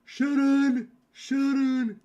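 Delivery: loud, high Aussie call of a name; high-pitched hollered Australian accent. Shown as a demonstration. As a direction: yell it out in broad Australian. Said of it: "two very different kinds of Aussie".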